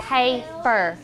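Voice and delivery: "Paper" split into pay, per; teacher's careful two-beat "Paper"; syllable by syllable. Shown as a demonstration. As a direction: separate both syllables of "Paper" clearly. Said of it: "How many syllables do you hear?".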